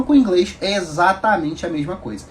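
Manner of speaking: ordinary talk, nothing marked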